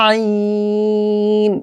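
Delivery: আইন stretched long, four alif; drawn-out ain. Shown as a demonstration. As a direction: hold আইন for four counts. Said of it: "চার আলিফ টেনে". (আইন চার আলিফ টেনে)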